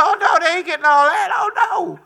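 playful high impression of the frugal wife; wife voiced, high and emphatic. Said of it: "She said".

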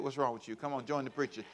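ordinary delivery

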